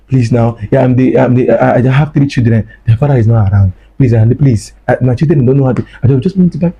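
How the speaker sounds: plain reading voice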